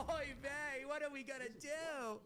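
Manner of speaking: put-on high whiny Yiddish accent.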